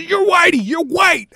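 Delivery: high-pitched insistent accusation; cussing heckler. Acted. Heckler cussing at the visitor, angry insistence. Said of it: "he started cussing at me".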